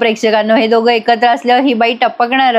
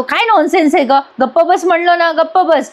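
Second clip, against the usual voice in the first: pitched well up, an imitated irritated husband; a hint of a husband losing his temper; mock angry retort; 6 semitones higher than usual. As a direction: retell his shut-up line higher and sharper, half-acted. Retold husband telling wife off.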